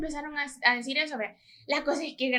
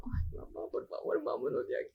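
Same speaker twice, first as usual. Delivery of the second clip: soft whispered begging, much softer; whispered pleading; 12 dB quieter than usual. Retold or imitated. Daughter begging mom to leave the stands, whispered plea. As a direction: plead under your breath to leave.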